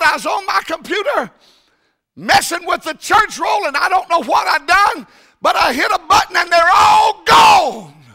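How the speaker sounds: high, loud, hammed-up wail; distressed secretary. Preacher voicing the secretary in a hammed-up wail. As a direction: play the panicked secretary.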